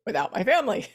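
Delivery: laughing while talking. Real, not acted. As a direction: chuckle through the words. Laughing at her own mistake.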